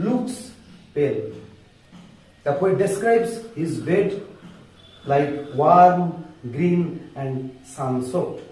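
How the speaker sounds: slow, word-by-word English with long pauses; speaking slowly. Shown as a demonstration. Teacher reading poem lines to students, slow and deliberate. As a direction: spell it out slowly so students can follow. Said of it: "আস্তে আস্তে বলছি".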